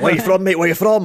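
hammed-up bouncer grilling him at the door; mock bouncer interrogation. Bouncers interrogating his accent.

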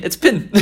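"pin" high, with a shifted New Zealand vowel; shifted Kiwi vowel. Shown as a demonstration. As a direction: show off "pin" in an exaggerated Kiwi way. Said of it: "you get vowels and you move it across one".

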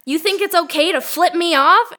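retold road-rage screaming, hammed up; imitating a screaming lady. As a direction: play the furious woman in the car, shouting. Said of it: "She starts screaming".

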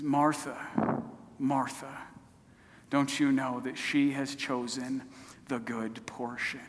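low, soft, tender reproof; tender rebuke. Acted. Jesus gently rebuking the woman busy serving, tender. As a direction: correct her gently, voice dropped low. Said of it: "but so tenderly".

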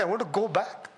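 ordinary delivery

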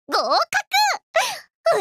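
high, bursting with joy; excited cheer. Acted. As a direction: shout the good news. Exam result revealed.

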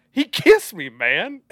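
high, loud comic disbelief; imitated indignant outburst; mock shocked complaint. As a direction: squeal it in hammed-up shock. Acts out a shocked film character.

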